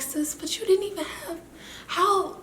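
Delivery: high-pitched imitation of a disbelieving sister-friend; playful high-pitched scolding; mock incredulous friend. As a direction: call yourself out in a high mock voice. Voicing a critic of her overreacting.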